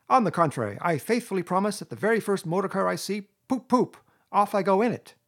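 high, loud gleeful defiance; emphatic. A narrator's reading. Toad vowing to drive off, emphatic. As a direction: vow it boldly, tooting like a horn. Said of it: "replied Toad emphatically".

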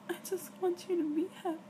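fast, tearful words through crying; crying while trying to comfort. Real, not acted. Girlfriend crying on Skype, comforting her crying partner. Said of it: "I know I am crying".

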